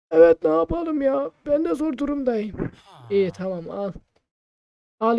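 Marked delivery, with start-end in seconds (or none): 0.1-2.7 higher shopkeeper shrugging it off; lightly voiced shopkeeper, apologetic. Shopkeeper justifying the price to player, higher voice.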